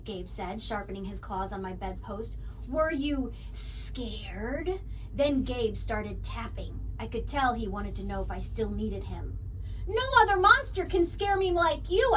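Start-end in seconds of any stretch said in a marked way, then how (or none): 2.7-4.8 whispering. Acted. Monster whispers slowly. 9.9-12.1 giggling. Acted. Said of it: "I giggled".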